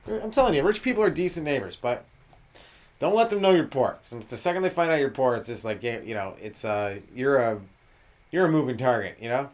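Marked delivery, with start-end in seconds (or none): none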